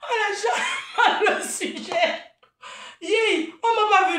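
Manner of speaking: slow, high, through laughter; laughing hard. Real, not acted. Vlogger cracking up at her own wig joke, laughing. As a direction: let the giggles take over the words.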